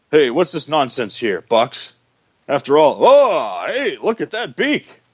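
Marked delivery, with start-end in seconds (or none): none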